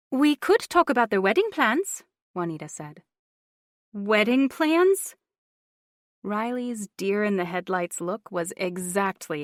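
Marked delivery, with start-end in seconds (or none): 0.1-2.0 scheming aunt, high and loud; older woman voice. A narrator's reading. Juanita voiced. 3.9-5.2 slow, rising, caught off guard; stunned question. A narrator's reading. Riley shocked.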